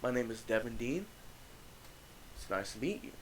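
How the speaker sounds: mock-polite introduction, lilting up; retold grinning hello, questioning lilt; big grin. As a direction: introduce yourself through a grin, softening toward the end. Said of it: "with a big ass grin on my face".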